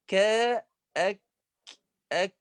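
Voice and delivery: أك with a strong stop and puff of air; letter demo. Shown as a demonstration. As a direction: pop the ك then release breath on أك. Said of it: "هنا حرف الكاف حرف قوي". (نطق الكاف الساكنة بشدة وهمس)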